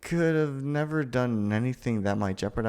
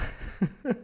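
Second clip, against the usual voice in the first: giddy laugh; 12 semitones higher, 3 dB quieter than usual. Acted.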